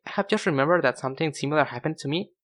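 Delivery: normal talking voice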